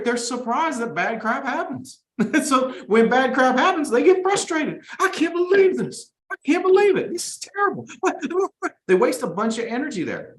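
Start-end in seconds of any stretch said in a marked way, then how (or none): 5.0-8.2 much higher, mock-outraged wail; whiny complainer. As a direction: play the outraged loser, pitched way up. Mocking a low performer's complaints.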